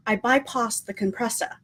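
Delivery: badly faked British accent, barely there; attempted British accent. Vlogger quoting Rey in a failed British accent. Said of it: "I completely crucified that accent".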